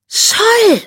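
sudden high, loud hiss of a name; sharp alarmed hiss; hissed cry. Acted. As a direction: spit the name out like a startled cat. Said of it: "fauchte sie".

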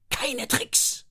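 high, snapping warning; hissed warning. Acted. Fanny warns both kids off, hissing. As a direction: spit it out as a threat. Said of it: "fauchte sie".

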